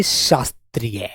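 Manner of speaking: slow dictation. Shown as a demonstration. Teacher dictating the last word, slow and low. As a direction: drag out शास्त्रीय for writing. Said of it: "शास्त्रीय लिख कर दिखा दो".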